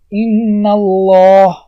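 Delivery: "ان الله" drawn out very slowly, the doubled lam of الله thick and heavy; slow tajweed recitation of ان الله with a full lam; heavy lam in Allah. Shown as a demonstration. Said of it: "دیدین؟".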